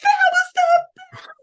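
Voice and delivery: very high, squealing delight; thrilled gush. Retold or imitated. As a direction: squeal it like a giddy teen. Daughter thrilled after the call.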